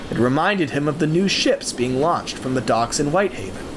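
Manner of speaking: plain reading voice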